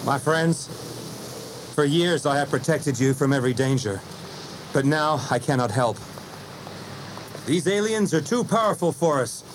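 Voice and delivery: unhappy hero. A narrator's reading. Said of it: "he finished unhappily".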